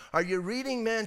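ordinary talk

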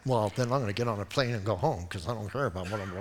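low, soft, quick grumble trailing away; mock grumbling. Preacher retells his own sulk over plans, grumbling low. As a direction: sulk under your breath, rattling it off. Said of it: "like, I'm all mad".

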